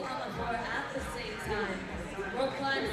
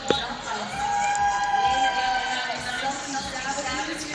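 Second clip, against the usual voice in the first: team roll call over the floor, building louder; arena announcer; 5 dB louder than usual. Real, not acted. MC introducing the lanes to the crowd, announcer voice. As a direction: call out each lane like an arena MC.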